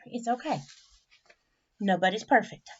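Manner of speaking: ordinary delivery, nothing marked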